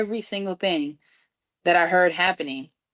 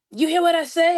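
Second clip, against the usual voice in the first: retold indignant demand, pitched way up; indignant caller; 10 semitones higher than usual. [name] calling back after being hung up on, indignant.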